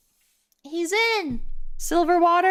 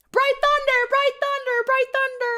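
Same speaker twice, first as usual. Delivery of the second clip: commanding alpha call, much higher. Acted. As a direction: shout the wolf's name across the pack. Alpha calling the new wolf.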